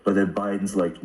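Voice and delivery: ordinary talk, nothing marked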